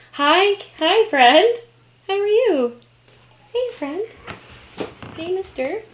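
high, sweet cooing hellos. Real, not acted. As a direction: greet the cat in a high coo. Greeting the cat.

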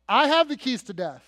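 loud, emphatic claim of authority; forceful preaching as the risen Christ; commanding declaration. Acted. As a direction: proclaim it with a hard-hit opening.

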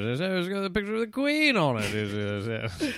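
stammering British bluster, trailing away; Boris Johnson. Acted. Prime minister puzzling over the twenty pound note, bumbling stammer. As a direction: splutter it like a bumbling politician. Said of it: "Ah, Boris".

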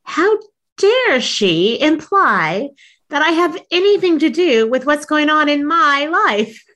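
high, slow hammed-up outrage with a laugh; mock indignation. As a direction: play up your old offended self, stretching each word. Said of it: "oh, I was mad".

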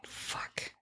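hushed, unvoiced mock dismay; dismayed whisper. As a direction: whisper it like a horrified parent. Acts parent's dismayed reaction.

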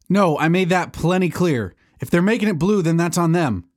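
snapping at someone offstage; irritated. Acted. Arguing with Kevin in earpiece.

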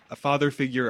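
normal talking voice

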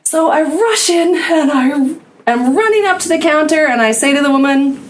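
usual speaking voice

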